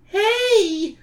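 put-on high, whiny kid voice. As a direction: whine it like a pesky kid. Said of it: "a whiny voice says".

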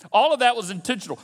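usual speaking voice

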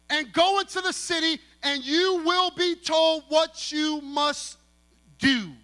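slow, high, loud stressed "Clear instructions"; emphatic preaching. Acted. Preacher driving home his point to the church, slow and loud. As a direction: stretch every word and hammer it home.

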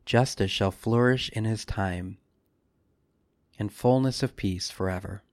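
ordinary delivery

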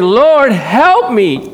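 a lightly voiced desperate plea; mild mock pleading cry. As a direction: cry out to the Lord for help. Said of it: "You know that cry?".

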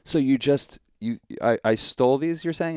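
can you believe this accusation; incredulous. Retold or imitated. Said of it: "I'm like".

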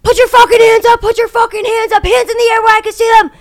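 loud, high barked police commands; cop yelling. Retold or imitated. Police officer shouting at the stunned realtor, loud. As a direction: yell it like a cop with his hand on his gun. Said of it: "he's like, excuse my French".

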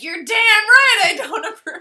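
hammed-up high, loud outrage; imitating her indignant, high-pitched shouting; acted super mad. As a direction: yell it as the furious character. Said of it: "she's super mad".

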